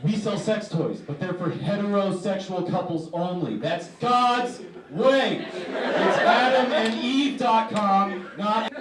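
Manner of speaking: loud, high preachy company spokesman; mock righteous proclamation. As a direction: build to a stretched-out, hammed-up holy shout. Acts out company's pious defence.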